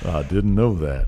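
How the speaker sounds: slow, low Southern drawl, put on; Southern accent. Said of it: "he's got the Southern accent now".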